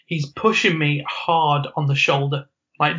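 normal talking voice

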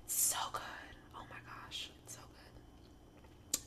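fluisterend; breathy ASMR whisper, slow at the end. Real, not acted. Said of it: "I sound like an A-, A S M R".

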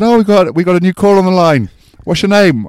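high, loud, gushing presenter; excited TV host. Retold or imitated. Shopping channel host welcoming a caller on air, high and loud.

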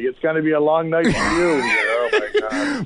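shouted taunt breaking into laughter; mock the opponent then crack up; laughing taunt. Tough guys taunting the opposing fighter, shouting then laughing.

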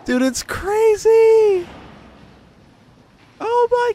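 very high, loud, drawn-out squeal; loud high-pitched shriek of disbelief; hysterical disbelief. Real, not acted. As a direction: squeal it slowly, helpless with amazement. Cracking up at the clip.

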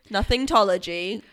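ordinary talk, nothing marked

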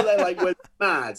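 ordinary delivery

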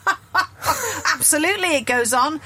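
high laughing agreement from a knowing listener; mock laughing listener. Listener putting her wine down, laughing and agreeing.

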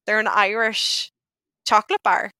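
ordinary delivery

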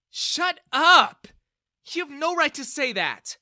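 very high, very loud shout; furious intervention. A narrator's reading. Daughter shouts her father down, furious. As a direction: cut him off hard. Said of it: "Bella intervened".

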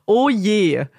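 ordinary talk, nothing marked

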